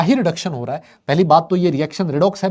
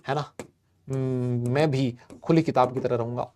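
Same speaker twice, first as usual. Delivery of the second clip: mock molecule voice; 4 semitones lower, 8 dB quieter than usual. Teacher voicing a molecule to the class, quiet and low mock boast. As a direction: lower and softer, a molecule boasting dreamily. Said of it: "H two F two भी कहता है".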